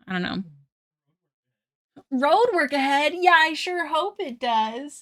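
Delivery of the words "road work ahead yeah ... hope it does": higher, slowed mock-earnest Vine line; lightly voiced meme quote. Streamer quoting a favorite Vine, lightly voiced higher. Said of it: "stuff usually from, like, Vines".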